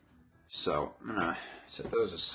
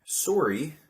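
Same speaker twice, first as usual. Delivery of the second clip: loud, high mock-Canadian "sorry"; Canadian accent; 8 semitones higher, 7 dB louder than usual. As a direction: exaggerate the rounded Canadian O in "Sorry". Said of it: "saying a boot and sorry".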